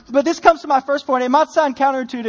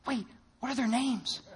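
hushed panic; 12 dB quieter than usual. Retold or imitated. Sudden whispered realization.

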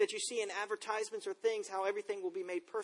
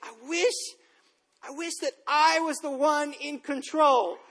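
put-on higher, slow, mopey little-kid voice, laughing through it; sulky little boy; 6 semitones higher, 7 dB louder, slower than usual. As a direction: imitate his small son sulking on the bed, slow and higher. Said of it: "and he says".

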